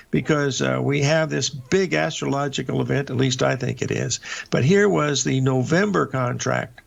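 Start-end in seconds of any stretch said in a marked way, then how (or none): none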